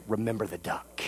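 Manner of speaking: quiet threat. Retold or imitated. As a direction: lean in and threaten him under your breath. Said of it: "she leans over to Johnny and says".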